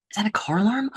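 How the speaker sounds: whispered, puzzled question; hushed alarm. Acted. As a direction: whisper it as if straining to hear. Acted inner dialogue, distracted.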